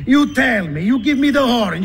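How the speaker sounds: ordinary talk, nothing marked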